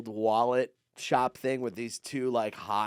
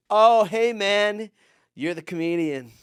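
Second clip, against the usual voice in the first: high, louder "Oh, hey, man"; mock call-out; 8 semitones higher, 8 dB louder, slower than usual. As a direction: call out to the comic walking by. Voicing the sandwich guy calling out.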